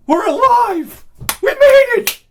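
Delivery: very high, loud, slow mocking cheer; hammed-up survivors cheering; mock celebration. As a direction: parody the clappers' triumph. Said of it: "mocking clapping passengers".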